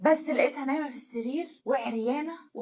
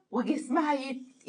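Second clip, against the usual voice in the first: ordinary talk, nothing marked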